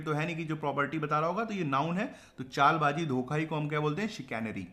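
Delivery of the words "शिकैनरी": usual speaking voice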